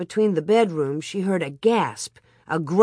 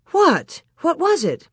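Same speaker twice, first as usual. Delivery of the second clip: alarmed; 10 semitones higher, 4 dB louder than usual. A narrator's reading. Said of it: "Ma asked".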